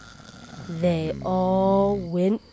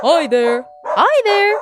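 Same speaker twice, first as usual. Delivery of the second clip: loud greeting; 9 semitones higher, 7 dB louder than usual. Acted. Sheep greeting loudly.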